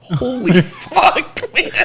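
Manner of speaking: high, slow, with a laugh in it; stunned as the balloon fills the sky; awed laughing curse. Retold or imitated. Watching a balloon come right at them, slow laughing awe. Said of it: "I'm like".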